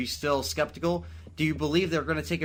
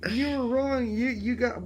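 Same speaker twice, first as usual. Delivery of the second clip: high whiny mock of a know-it-all commenter; 11 semitones higher than usual. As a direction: sneer it back like a smug YouTube commenter. Mocking the commenters' complaint.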